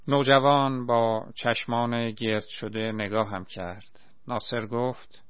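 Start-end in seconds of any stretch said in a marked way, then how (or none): none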